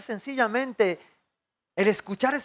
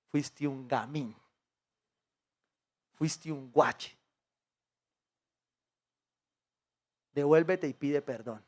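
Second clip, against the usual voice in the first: low, soft, slow inner voice scolding; hushed scolding inner voice; voice of conscience; 6 semitones lower, 7 dB quieter than usual. Retold or imitated. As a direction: murmur it like a conscience nagging you. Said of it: "esa tensión que te dice".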